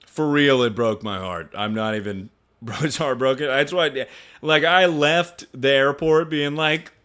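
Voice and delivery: ordinary delivery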